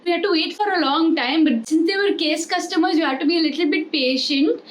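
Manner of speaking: mock Gujarati accent, hole for hall. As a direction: imitate a Gujarati colleague, case for cash. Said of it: "he had a Gujarati accent".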